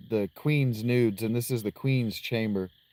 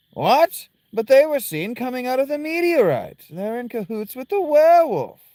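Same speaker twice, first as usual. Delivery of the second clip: high, loud, alarmed character voice; high-pitched game character, incredulous; 14 semitones higher, 9 dB louder than usual. Acted. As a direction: cry out in high-pitched disbelief.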